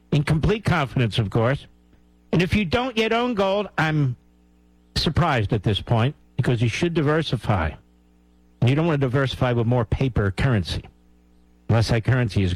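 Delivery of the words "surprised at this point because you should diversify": normal talking voice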